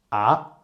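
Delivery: "ah" gliding steadily upward; rising tone. Shown as a demonstration. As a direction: lift "ah" like a surprised question. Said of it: "imagine you get surprised".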